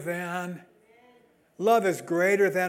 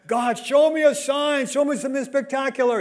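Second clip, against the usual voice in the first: mock pleading prayer; 7 semitones higher, 4 dB louder than usual. Mocking people demanding a sign.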